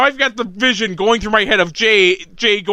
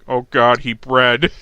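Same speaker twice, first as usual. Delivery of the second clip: low, dismayed aside; mock dismay; 7 semitones lower, slower than usual. Co-host voicing his horrified inner reaction, low mock dismay. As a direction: drop low for the horrified half. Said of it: "other part of me is going".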